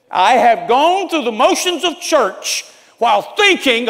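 high, loud outburst of confession; impassioned shout. Real, not acted. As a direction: cry it out honestly.

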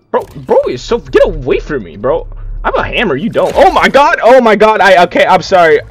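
loud, high-pitched gamer panic; flustered yelling at an attacker; panicked. Real, not acted. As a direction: build from alarmed protest to a loud yelp.

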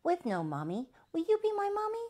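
put-on pleading baby, rising high; little baby. A narrator's reading. Baby asking the old uncle, high childlike plea. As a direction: ask high and eager, like a small child. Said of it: "said the little baby".

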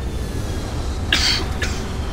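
leftover coughing after choking; rough clearing cough. Real, not acted. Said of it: "se me ha ido por el otro lado". (tos tras atragantarse)

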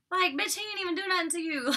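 high-pitched exasperated outburst; exasperated scolding. Acted. Recapper telling off the rude girl, exasperated.

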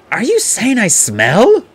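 high, loud, slow indignant question; stung and affronted, pitched right up; embarrassed and angry. A narrator's reading. Young man asking if he smells, embarrassed and angry. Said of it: "both embarrassed and angry".

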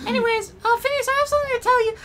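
squeaky, rushed falsetto; high fast girl voice. Acted. Girl about to confess to Phineas, squeaky. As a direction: rush it out in a squeak.

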